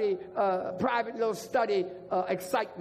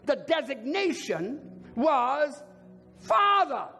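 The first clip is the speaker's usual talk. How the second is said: full-force preaching; 3 semitones higher, slower than usual. Real, not acted. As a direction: hammer out the title with pauses.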